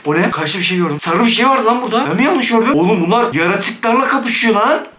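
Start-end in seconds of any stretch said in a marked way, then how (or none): none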